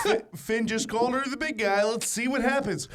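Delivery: mock phone commentary. Pretends to film mom's attack.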